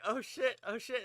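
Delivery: high-pitched alarmed muttered curses; mock alarm. Player retelling panic after snapping the ghost, high mock alarm.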